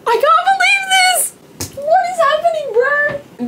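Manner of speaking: very high, stretched squeal; squealing disbelief. Real, not acted. Fan to her viewers, squealing in disbelief. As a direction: squeak each word in joyful shock. Overwhelmed joy.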